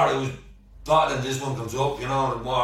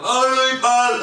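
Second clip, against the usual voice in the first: put-on drunk greeting; 10 semitones higher, 6 dB louder, slower than usual. Drunk mate's greeting, retold.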